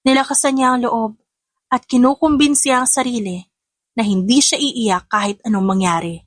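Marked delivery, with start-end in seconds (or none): none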